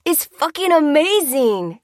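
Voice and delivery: high, slow drawn-out exclamation; party reveller crying out in delight; elated cry. A narrator's reading. Random interviewee to street reporter, elated cry. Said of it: "cried the random interviewee".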